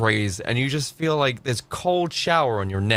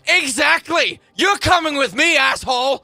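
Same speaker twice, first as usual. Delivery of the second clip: high, loud mocking shout; mock arrest shout; 10 semitones higher, 5 dB louder than usual. Streamer voicing the cop, shouting the arrest. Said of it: "and they went".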